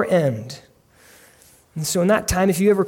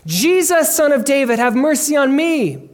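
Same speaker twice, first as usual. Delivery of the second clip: acted screaming plea; 8 semitones higher, 4 dB louder than usual. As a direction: cry out urgently, pitched well up. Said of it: "screaming at the top of his lungs".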